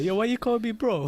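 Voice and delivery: imitating sister. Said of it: "my sister would be like".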